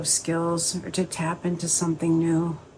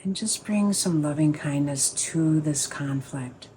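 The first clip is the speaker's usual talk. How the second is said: calm, unhurried meditation guide, pausing between phrases; guided meditation. Acted. Teacher easing the group into a sit, guided meditation voice.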